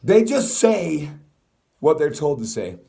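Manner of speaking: indignant loud rant; loud emphatic. Real, not acted. Ranting emphasis.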